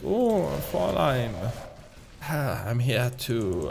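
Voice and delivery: evil movie villain with a badly faked German accent. Shown as a demonstration. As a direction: attempt a sinister German accent. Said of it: "like, the voice that I'm trying to do".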